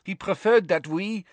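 rushing to explain himself; hurriedly. A narrator's reading. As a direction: Karkaroff anxiously explaining to the court. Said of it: "said Karkaroff hurriedly".

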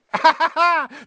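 high, loud mocking laugh; gleeful cackle. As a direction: cackle in triumph at the victim. Peeves gloats over tricked caretaker.

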